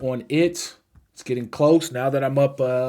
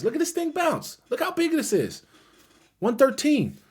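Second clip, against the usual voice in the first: much higher, thrilled voice; excited; 11 semitones higher than usual. Real, not acted. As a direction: react with real excitement. Stock bouncing past his target.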